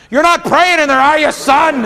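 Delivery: loud, high suspicious dad; dad pounding on door. Pretend. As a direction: call through the door, loud and accusing.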